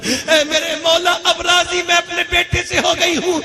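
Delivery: mother's tearful prayer rising to a loud cry; weeping mother begging forgiveness for her son, crying out; tearful plea. Acted. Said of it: "ماں روتے روتے کہتی ہے".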